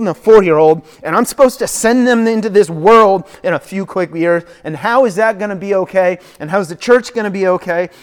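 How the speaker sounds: loud, high, anxious rush of questions; a parent watching the news, frantic; panicked worrier. Retold or imitated. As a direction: spiral into frantic worry. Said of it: "put on the news and go".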